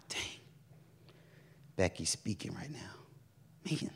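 slow whispered "dang"; disappointed whisper. Retold or imitated. Preacher realizing she's missing Becky's sermon, whispered. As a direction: breathe it out, annoyed at being late.

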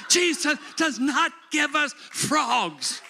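high, loud preaching declaration; preaching at full force. Real, not acted. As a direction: proclaim it loud to the congregation.

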